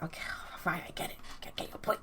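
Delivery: very soft, breathy give-in; grudging mumble. Acted. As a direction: concede under your breath. Sam gives in.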